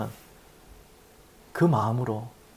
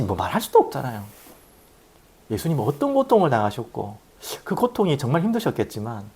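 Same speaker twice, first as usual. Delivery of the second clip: normal talking voice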